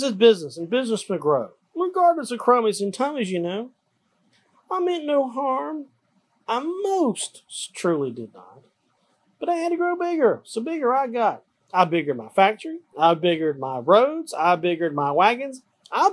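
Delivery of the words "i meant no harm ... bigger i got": Onceler voice. A narrator's reading. Onceler defending himself.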